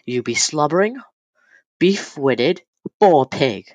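exaggerated mock Shakespearean accent; put-on accent. Shown as a demonstration. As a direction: hurl it in a hammed-up accent, each part lifted. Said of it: "See, I added an accent".

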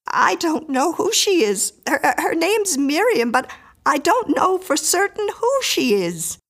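high, anxious, faltering woman; frightened Mrs Miller. A narrator's reading.

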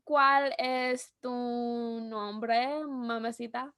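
slow, careful Spanish "¿cuál es tu nombre, mamacita" with rolled accent. Shown as a demonstration. Speaker showing her friend her best Spanish accent. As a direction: put on a flirty Spanish accent, drawn out. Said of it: "did I say that right".

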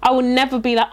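normal talking voice

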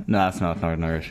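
low, half-hearted Northern Irish accent; Northern Irish accent attempt. Shown as a demonstration. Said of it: "Go on, give us an impression".

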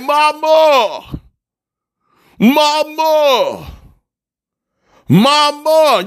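put-on high child calling mama in the night; child crying out. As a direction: call out like a scared kid at night. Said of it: "Children be crying in the middle of the night".

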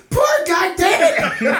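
high, loud laughter through the words; can barely get it out for laughing; cracking up while talking. Real, not acted.

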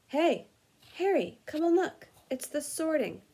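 eager call. Acted. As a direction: call him over excitedly. Ron calls Harry to the window.

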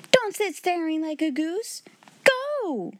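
high-pitched, scolding command; scold, then cry out the order; fierce. A narrator's reading. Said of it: "was the fierce answer".

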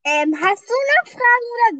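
talking loudly over headphone music; can't hear herself, speaking up; too loud, headphones on. Real, not acted.

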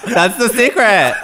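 high-pitched, talking through laughter; laughing. Real, not acted. Telling co-host about a silly theory, laughing. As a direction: crack up while getting the words out. Cracking up at own joke.

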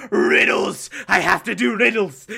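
frantic and obsessed, spitting out riddles; a compulsive villain who can't stop himself; manic Riddler. Retold or imitated. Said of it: "Riddler's like".

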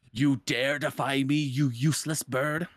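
slow, menacing villain voice; dark lord. Retold or imitated. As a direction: loom over the bird with contempt. Jafar threatens Iago.

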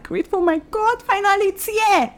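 acted overjoyed relief, pitched high; grateful relief. Student seeing the admission letter at last, grateful relief. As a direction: play someone thankful at long-awaited news, voice lifted. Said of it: "you have the right to cry because now you're just like".

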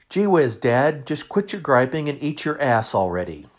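put-on loud, high kid's scream; screamed across the table. Thirteen-year-old son yells at dad to eat, loud and high. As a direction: yell it across the whole restaurant. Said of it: "screamed across the table".

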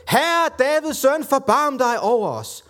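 high, drawn-out cry from the crowd; narrated shout for mercy; calling out. A narrator's reading. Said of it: "så begyndte de at råbe".

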